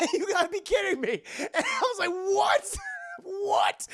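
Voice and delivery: high, laughing outrage; laughing disbelief. Real, not acted. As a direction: cackle in disbelief. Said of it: "disbelief while laughing".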